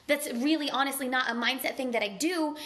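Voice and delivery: ordinary delivery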